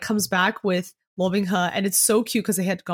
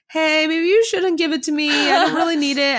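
higher-pitched, acted polite protest; polite refusal; 6 semitones higher than usual. Retold character turning down a gift, high polite protest. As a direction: pitch it up as a reluctant friend.

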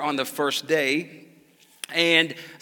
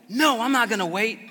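put-on higher, louder, defiant kid; sassy refusal; 7 semitones higher, 6 dB louder than usual. Father imitating son refusing to wait, sassy. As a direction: snap back like a bratty four-year-old. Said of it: "he normally doesn't talk like that".

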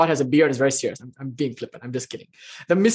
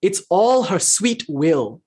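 slow, gentle; much slower than usual. Retold or imitated. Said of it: "Sri Ramakrishna goes one step further".

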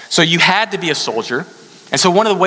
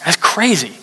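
normal talking voice

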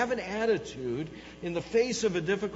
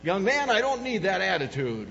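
louder mock-stern mom; scolding mother; 3 semitones higher, 7 dB louder than usual. Mother to her young son, loud mock scolding. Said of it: "my mother would, uh, say something to me like".

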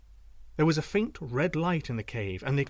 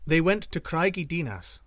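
ordinary delivery

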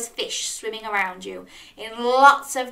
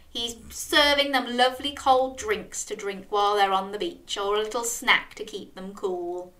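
ordinary delivery

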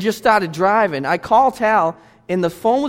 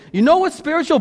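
pitched up high, calling out the question; rising question; 8 semitones higher than usual. Real, not acted. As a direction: throw it out to the room, high. Preacher's repeated rising question.

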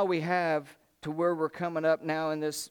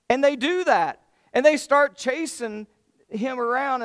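higher, louder, building the chase; preacher caught up in the battle, pitched up; excited telling; 7 semitones higher, 8 dB louder than usual. A narrator's reading. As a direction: tell it like the action is on. The chase of the king.

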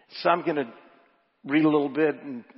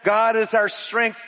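raised, emphatic sermon delivery; preaching; 7 semitones higher, 6 dB louder than usual. Acted. Preacher reads a commentary to the congregation, raised emphatic preaching. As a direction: preach the quotation with building conviction.

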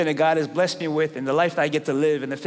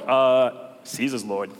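bored pagan; 5 semitones lower, slower than usual. Acted. Acted unimpressed pagan reply.

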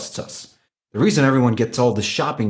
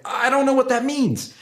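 lifted much higher, puzzled and helpless; confused consumer; 13 semitones higher than usual. Retold or imitated. Speaker imitating a consumer lost by mortgage jargon, much higher and confused. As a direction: play the baffled buyer who doesn't get it.

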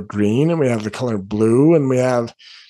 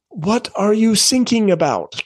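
higher-pitched imitated German accent, s for th; lightly put-on German-accented English; 7 semitones higher than usual. German Coast Guard answering a radio distress call, German accent. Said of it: "in the best English that he could muster".

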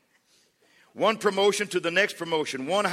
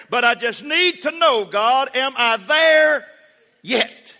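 high, loud, mounting prayer; impassioned plea; 7 semitones higher, 7 dB louder than usual. Acted. Believer begging God to say if he's arrived, impassioned.

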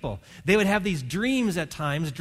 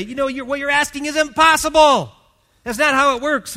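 protesting astrologers; 9 semitones higher, 9 dB louder than usual. Acted.

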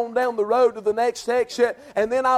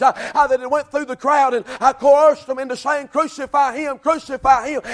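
full-force preaching; 4 semitones higher than usual. Acted. Preacher stirring the church about the cross, impassioned.

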